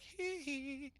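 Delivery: very high falsetto squeal; [name] hee hee. Retold or imitated. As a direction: squeak it like the King of Pop. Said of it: "busts out some [name]".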